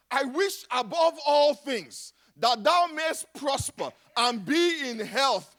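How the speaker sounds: usual speaking voice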